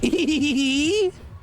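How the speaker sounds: high, drawn-out silly giggle; put-on character laugh. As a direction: giggle high as a goofy fake personality. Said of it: "I'm working on a personality".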